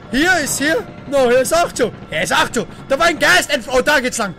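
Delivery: ordinary talk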